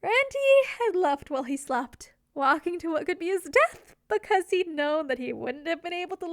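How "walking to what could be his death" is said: ordinary delivery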